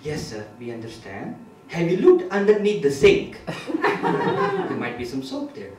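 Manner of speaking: put-on courteous Indian-accented call-centre agent; Indian-accented helpline patter. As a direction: humour the caller in an Indian accent. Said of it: "switch from Indian to English accent".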